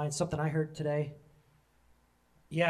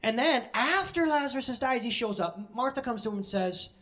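ordinary talk

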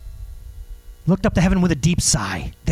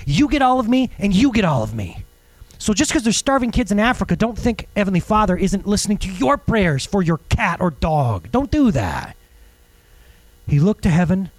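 ordinary delivery

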